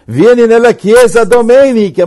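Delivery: loud, high, hammed-up preacher's invitation; mock a street evangelist bullying someone into church; pushy evangelist. Parodies a pushy preacher inviting a stranger, loud and high.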